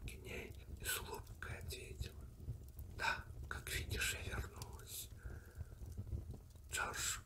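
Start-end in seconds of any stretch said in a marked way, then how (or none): none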